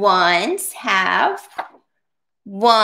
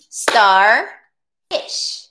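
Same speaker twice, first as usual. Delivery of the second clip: "Starfish" split in two, star, pause, fish; "Starfish" syllable by syllable, "Star" held, then "fish"; two separate syllables. Shown as a demonstration. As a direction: say "Starfish" as two beats with a clear gap. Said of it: "Two syllables".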